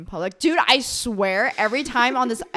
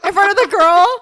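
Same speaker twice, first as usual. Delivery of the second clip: loud high disbelieving shout; shocked; 8 semitones higher, 13 dB louder than usual. Real, not acted. Shocked at friend's story.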